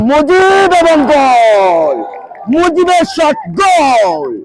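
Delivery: long, drawn-out goal cry; excited goal call. Real, not acted. Commentator hailing a player's scored penalty, elated drawn-out shout. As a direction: hold the vowels and let them fall away.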